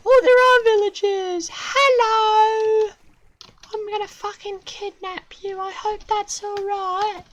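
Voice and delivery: very high, chirpy menacing voice; a high cartoon kidnapper voice; squeaky villain. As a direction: cheerfully threaten the villagers in a squeak.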